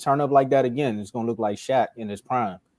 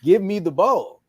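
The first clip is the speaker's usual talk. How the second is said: loud mock demand, a bit higher; demanding big man; 6 semitones higher, 5 dB louder than usual. Big man posting up and calling for the ball, loud and demanding. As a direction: call for the ball, hammed-up and loud. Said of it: "he's like".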